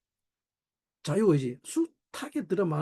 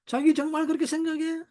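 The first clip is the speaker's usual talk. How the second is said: much higher, challenging voice of a wife; imitated confronting wife; 11 semitones higher than usual. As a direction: mimic a wife challenging her husband in a high voice. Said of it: "우리 아내가 제게 하는 말이". (따지는 아내 흉내, 높은 목소리)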